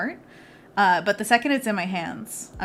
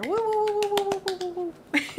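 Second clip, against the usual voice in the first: high-pitched crowd-style cheer; celebratory whooping; 12 semitones higher than usual. Real, not acted. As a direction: cheer for a friend with high woos. Cheering a round of applause.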